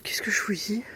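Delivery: frightened breathy whisper; scared whisper. Real, not acted. Scared alone at night.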